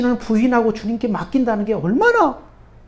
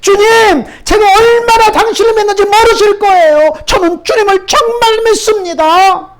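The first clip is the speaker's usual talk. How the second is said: higher and louder, desperate; louder shout; 9 semitones higher, 5 dB louder than usual. Acted. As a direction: shout up to God that you truly believe. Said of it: "더 크게 소리 질렀습니다".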